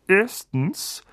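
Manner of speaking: uncle growling the first rule; growled. A narrator's reading. Uncle laying down rules to Harry, growled. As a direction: growl it as a warning. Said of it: "knurrte Onkel Vernon".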